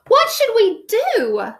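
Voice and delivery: high, slow, anxious king voice; a fretful king pleading for answers; worried king. Acted. King asking his advisors, high and worried.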